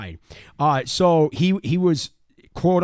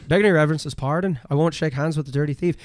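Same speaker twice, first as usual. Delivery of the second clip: Irish brogue; 3 semitones higher, 4 dB louder, faster than usual. Retold or imitated. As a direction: redo the Irish brogue with more force. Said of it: "less like a little leprechaun".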